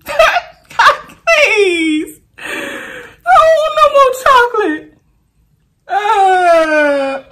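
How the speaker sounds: high, laughing mock cry; begging the wheel through laughing cries; laughing whine. Real, not acted. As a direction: plead through laughter, half crying. Begging not to eat chocolate.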